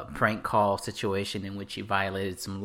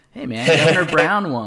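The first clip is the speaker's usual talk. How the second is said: loud laughing protest; 6 semitones higher, 11 dB louder than usual. Real, not acted. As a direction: laugh it out loudly in defence. Laughing defensive reply.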